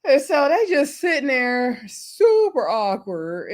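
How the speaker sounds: usual speaking voice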